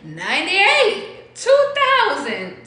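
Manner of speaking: rising excited shout of years; excited loud count. Real, not acted. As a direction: belt the years out, climbing.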